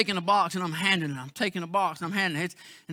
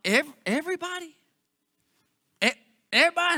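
amazed question; 5 semitones higher, faster than usual. Retold or imitated. Asking the bus riders, amazed.

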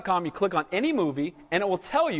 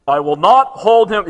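a loud, slow declaration on NOT; loud and slow with heavy weight on not; booming divine warning; 10 dB louder than usual. Acted. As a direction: thunder it out like God from the mountain. Booms God's warning from the verse.

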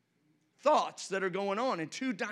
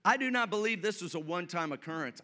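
ordinary delivery

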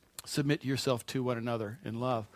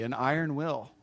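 ordinary delivery

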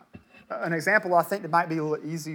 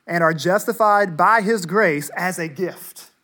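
louder scripture reading with higher pitch; emphatic reading; 3 semitones higher, 9 dB louder than usual. Real, not acted. Preacher reads verse twenty-four to the church, loud and emphatic. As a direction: proclaim the verse to the whole room.

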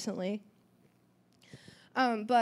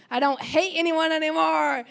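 joyful relief; 6 semitones higher, 6 dB louder than usual. Retold or imitated. Relief after forgiving.

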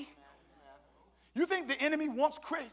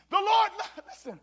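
usual speaking voice

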